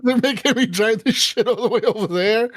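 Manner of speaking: high-pitched, laughing through a mock complaint; laughing imitation. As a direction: crack up while imitating a griping driver. Laughing while quoting angry tow driver.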